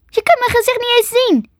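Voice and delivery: high-pitched indignant retort; defiant girl. A narrator's reading. Girl contradicts the creature in the dark, high and indignant. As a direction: argue back in a high girl's voice.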